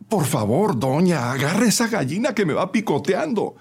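high-pitched nervous plea; very scared. A narrator's reading. Young man pecked by a lady's hen, scared. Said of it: "muy asustado".

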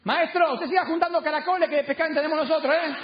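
high-pitched, exasperated brush-off; tired of unwanted advice, calling back high; fed-up retort. Acted. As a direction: shout back at a meddling stranger. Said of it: "Entonces Juan se cansa".

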